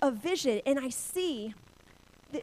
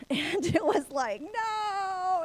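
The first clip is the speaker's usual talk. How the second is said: drawn-out, high mock-wail of "no"; anguished wail; 8 semitones higher, 5 dB louder, much slower than usual. Preacher reliving a hard season, anguished wail.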